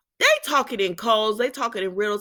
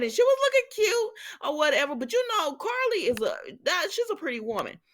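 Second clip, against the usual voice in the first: much higher, gushing about Carly; 9 semitones higher than usual. Real, not acted. Vlogger gushing about Carly's outfit, high and excited. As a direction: gush over her look.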